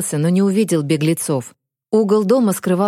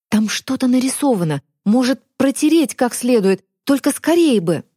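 higher, hurried and pleading; urgent girl voice; 6 semitones higher than usual. Acted. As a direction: press for speed, a little breathless.

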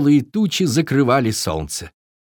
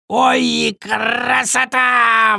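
high, drawn-out raven cry with a long rolled r; exclaiming raven; 12 semitones higher, 3 dB louder, much slower than usual. A narrator's reading. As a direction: crow it out high and delighted. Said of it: "воскликнул ворон".

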